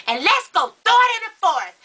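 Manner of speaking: put-on high, eager child; little girl voice. Little cousin wanting to toss the ball pieces, high child voice. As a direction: chirp it like an excited kid.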